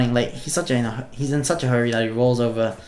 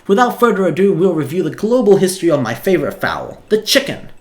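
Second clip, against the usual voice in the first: higher, louder excited little dog professor; a tired tiny dog calling class to order, pitched up; game streamer voicing a tiny dog professor addressing students, raised high and loud; 6 semitones higher, 6 dB louder than usual. Sprinkles starts lecture.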